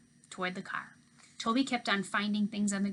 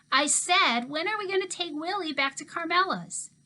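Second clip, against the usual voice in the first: put-on insistent kid; 8 semitones higher, 6 dB louder than usual. Toby insists, yanking backpack.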